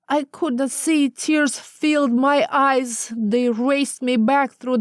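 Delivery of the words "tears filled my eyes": usual speaking voice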